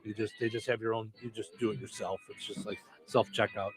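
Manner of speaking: ordinary talk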